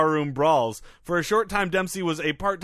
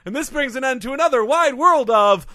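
hammed-up sign-off; 7 semitones higher, 6 dB louder than usual. Show sign-off to listeners.

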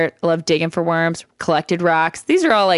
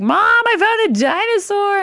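put-on excited kid voice. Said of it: "And I was like".

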